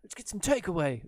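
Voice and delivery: mock Australian accent, high and loud on crikey; quick jokey Australian accent impression. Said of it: "if you think of an Australian accent".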